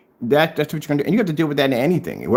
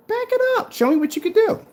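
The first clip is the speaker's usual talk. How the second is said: taunting challenge. Real, not acted. Taunting a rival to prove claims.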